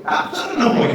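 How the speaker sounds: high, soft, petulant mimicry; mock refusal. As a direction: mimic a petulant churchgoer under your breath. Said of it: "cuando a veces decimos".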